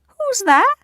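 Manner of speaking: high, loud granny impression; wolf pretends to be grandma; fake granny voice.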